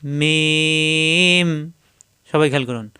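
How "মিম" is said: মিম held very long, closing in a hum; lips closing again. Shown as a demonstration. Teacher modelling the letter for learners, drawn-out articulation. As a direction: open from m and close back on মিম. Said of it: "আবার মিলাতে হবে".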